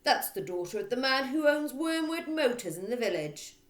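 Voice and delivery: bullying headmistress barking her reply. Acted. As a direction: bark it at the teacher. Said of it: "Miss Trunchbull barked".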